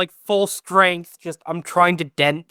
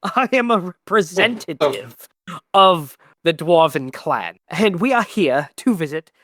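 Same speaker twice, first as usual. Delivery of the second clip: insulted noble. Acted. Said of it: "like an insulted noble".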